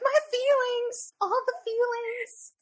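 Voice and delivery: high, slow hammed-up wail of feelings; mock anguish. Retelling her own texts to her co-host, slow high mock wail. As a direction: stretch it out like a melodramatic wail. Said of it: "messages from me being like".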